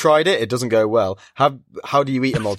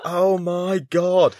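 long drawn-out, higher mock despair; retold meltdown; 7 semitones higher, much slower than usual. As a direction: stretch it out as if melting down. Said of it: "she's just melting down outside".

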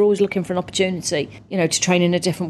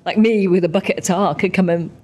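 ordinary talk

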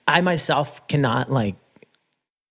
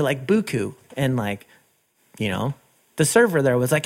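ordinary delivery

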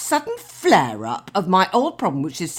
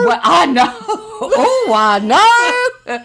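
high, loud mock-civil sitcom voice with laughter; hamming up a Fawlty Towers line, half laughing; Fawlty Towers quote; 13 semitones higher, 8 dB louder, much slower than usual. Quoting Fawlty Towers to her co-host, hammed-up and laughing. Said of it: "that is from Fawlty Towers".

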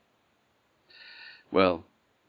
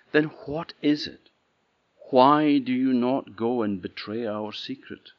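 insistent questioning; 5 semitones higher, 6 dB louder than usual. Acted. Lena presses the gardener.

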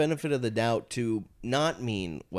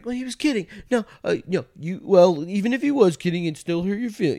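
higher, louder mock fretting inner voice; imagined inner debate; 8 semitones higher, 5 dB louder than usual. Mocking a friend stewing over a joke, in a high fretting voice. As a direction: play her arguing with herself. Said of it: "She's probably tossing and turning".

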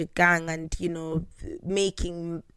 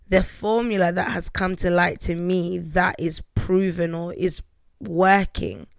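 ordinary talk, nothing marked

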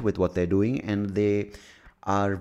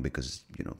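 usual speaking voice

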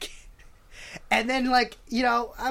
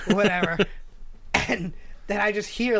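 ordinary talk, nothing marked

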